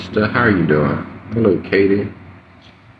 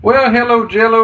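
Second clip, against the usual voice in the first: bright, sing-song hello, much higher; playful high greeting; 12 semitones higher, 4 dB louder than usual. Real, not acted. Playful greeting back on stream.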